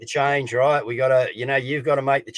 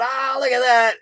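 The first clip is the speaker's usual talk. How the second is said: mock distracted onlooker; 10 semitones higher, 3 dB louder than usual.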